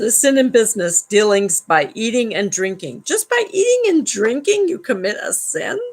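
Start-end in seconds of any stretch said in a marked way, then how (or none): 3.0-5.8 incredulous. Real, not acted. Incredulous reaction to the book.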